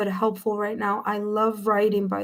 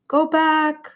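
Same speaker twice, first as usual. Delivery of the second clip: normal talking voice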